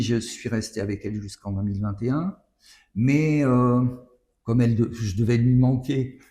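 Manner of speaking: normal talking voice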